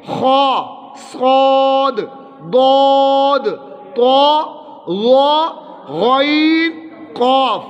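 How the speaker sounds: خا، صاد، ضاد thick and rounded, long "aw"-coloured vowel; full-mouthed heavy letters. Shown as a demonstration. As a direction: pronounce صاد، طا، قاف heavy, one by one. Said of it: "موٹے پڑھے جاتے ہیں".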